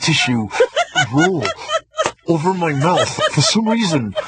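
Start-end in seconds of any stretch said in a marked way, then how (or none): 0.5-1.1 laughing burst. Real, not acted. Host laughing at voice bit.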